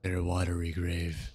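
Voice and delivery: low, solemn voice of the ocean; grave, deep-pitched sea entity; ominous ocean. Acted. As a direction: pronounce doom in a low, even register.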